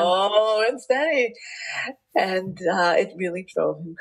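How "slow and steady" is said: very slow, higher, stretched vowels; long, lingering slo-o-ow; drawn-out delivery. Retold or imitated. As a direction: drag out each word to show the slowness. Repeats husband's words, stretched out.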